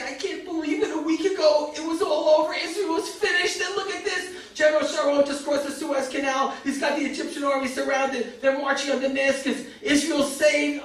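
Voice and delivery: high, overjoyed retelling of the news; high, tearful elation; joyful tears. Retold or imitated. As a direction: voice a man weeping with happiness. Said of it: "and he was crying".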